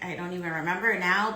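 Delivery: ordinary talk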